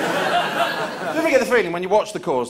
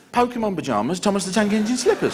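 usual speaking voice

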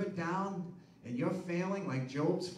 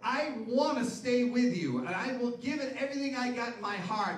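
earnest plea; 7 semitones higher, 6 dB louder than usual. Retold or imitated. Peter's ideal plea, voiced loud.

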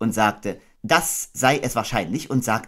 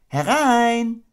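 high, louder call; calling come in; 13 semitones higher, 5 dB louder than usual. Acted. Kanga calling to the knock at the door.

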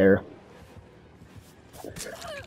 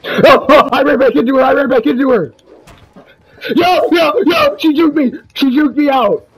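high shrieking excitement; hyped shouting; 12 semitones higher, 6 dB louder than usual. Real, not acted. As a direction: yell like you just got outplayed. Excited chase yelling.

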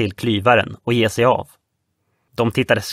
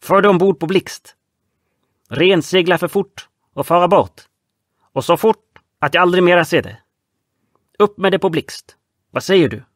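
broken-Swedish foreign sailor; 6 semitones higher than usual. Acted. Franske Pete arguing with Red Nelson about the safe. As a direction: argue in a thick foreign accent.

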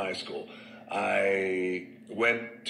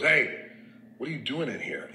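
sudden loud, higher hey, then calmer; retold coach yell; 5 semitones higher, 3 dB louder than usual. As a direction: call out a kid caught in the teachers' bathroom. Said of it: "and he was a badass".

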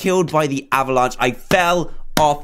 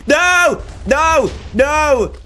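yelling no; 9 semitones higher, 3 dB louder, much slower than usual. Real, not acted. Falling again.

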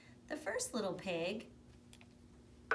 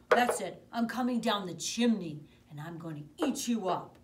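very loud wolf threat; furious wolf; 2 semitones higher, 12 dB louder than usual. Acted. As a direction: bellow the threat down the chimney. Wolf decides to attack.

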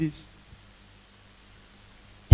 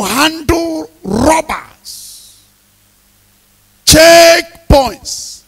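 loud, slow shouted list, each item hammered; preaching at full force; 10 semitones higher, 11 dB louder, much slower than usual. Acted. Preacher warning congregation of road dangers, loud and slow. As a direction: call out every danger from the pulpit.

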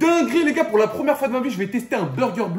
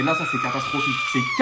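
outraged shout. Real, not acted. Outraged at ruined burger.

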